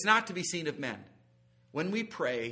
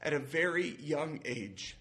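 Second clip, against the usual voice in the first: slow, weighty reading of a quote; slow solemn quote; 2 semitones lower, slower than usual. Retold or imitated. Preacher reading a quote to his church, slow and solemn. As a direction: let each phrase sink in. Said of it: "It said".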